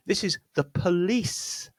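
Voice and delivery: police with a weak schwa then a stressed lee; slow, lean on the lee in police. Shown as a demonstration. Teacher modelling police for learners. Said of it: "This word has two syllables".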